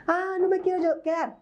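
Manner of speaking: put-on high, whining child's complaint; whiny child. Parent imitating a crying child's high whine. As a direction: mimic a child whining to get its way.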